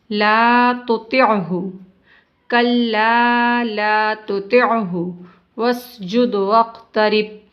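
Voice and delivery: slow, drawn-out tajweed recitation; measured Quranic recitation. Shown as a demonstration. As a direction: chant each word slowly, stretching the vowels. Teacher recites Quran verses.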